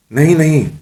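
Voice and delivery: high, alarmed double no; hasty refusal. A narrator's reading. Mullah turns down the witch's proposal, hastily. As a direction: cut in to refuse at once. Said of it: "ملا جی جلدی سے بولے".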